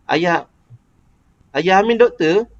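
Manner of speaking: "Ayah Amin doktor" pitched high, ending lifted; question intonation. Shown as a demonstration. Teacher giving an example of a question sentence, question intonation. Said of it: "dia lebih tinggi sikit daripada ayat penyata".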